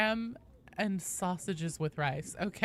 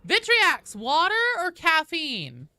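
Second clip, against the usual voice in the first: high, loud, hailing call; loud greeting; 10 semitones higher, 9 dB louder than usual. Real, not acted. Streamer greeting viewers as they arrive, loud greeting.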